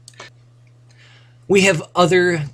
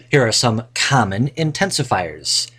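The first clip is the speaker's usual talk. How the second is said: normal talking voice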